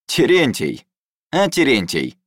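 loud, high fake cheer; forced cheer. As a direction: hail him with put-on joy. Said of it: "делано весело заговорил Атикс".

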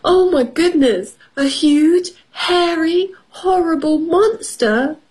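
high, aghast storybook rabbit; hammed-up rabbit alarm. A narrator's reading. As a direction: gasp it out in a high rabbit voice, rising. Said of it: "Rabbit said".

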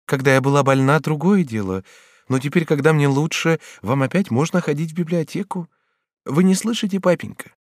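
high, gentle heroine voice; coaxing Batilda. Acted. Batilda urges papa back to the library, coaxing. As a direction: coax papa, rising to a question.

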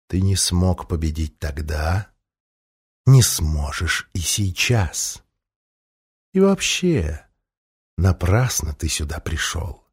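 low, slow, velvety dragon voice; a calm, menacing dragon speaking slowly; velvet dragon voice. A narrator's reading. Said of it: "голос опять зазвучал". (низкий бархатный голос дракона)

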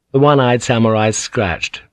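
ordinary delivery, nothing marked

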